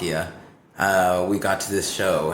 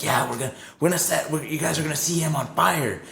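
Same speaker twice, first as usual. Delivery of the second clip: higher-pitched eager hype; super amped up; 6 semitones higher than usual. Retold or imitated. Crew promises the band a fire stunt, super amped up. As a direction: imitate amped-up hosts, pitch climbing. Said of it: "super amped up about it".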